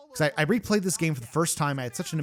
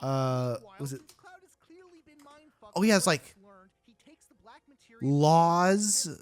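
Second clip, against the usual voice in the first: usual speaking voice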